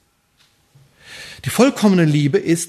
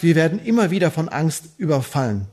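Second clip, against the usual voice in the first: ordinary talk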